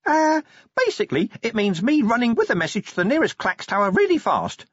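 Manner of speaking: hoping to be sent off on a run; hopeful imp. Acted. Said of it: "said the imp hopefully".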